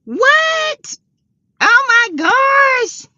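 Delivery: high, slow astonished gasp of words; surprised. Real, not acted. Host hearing it snows in Fargo, high astonished delight. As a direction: react in shocked delight, drawn out. Viewer reports snow in Fargo.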